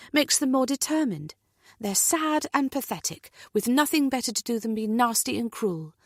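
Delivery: firm, comforting woman's voice; brisk support, a touch indignant; reassuring friend. Acted. Natalie consoling, scornful of bullies.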